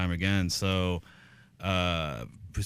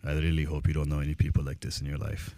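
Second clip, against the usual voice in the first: lightly voiced Cuban dad accent, lower; thick Cuban accent; 4 semitones lower than usual. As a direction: imitate his Cuban father, heavy accent. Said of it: "very thick Cuban accent".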